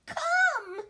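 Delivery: hammed-up crying brat, slow and high; fake sobbing. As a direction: whine it like a spoiled boy faking tears. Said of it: "between huge pretend sobs".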